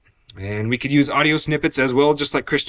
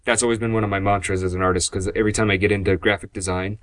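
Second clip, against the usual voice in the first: usual speaking voice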